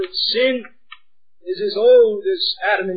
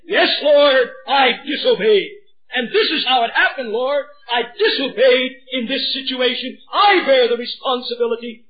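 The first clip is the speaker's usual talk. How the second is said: higher and louder, owning up fully; earnest confession; 4 semitones higher, 4 dB louder than usual. Acted. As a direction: confess it openly before the Lord. Voicing ideal confession.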